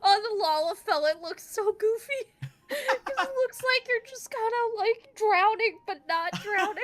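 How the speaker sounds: laughing. Real, not acted. Laughing at the goofy swim.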